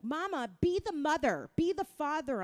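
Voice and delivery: bossy little daughter. Retold or imitated. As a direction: order her around like a small kid. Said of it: "My daughter Isabella".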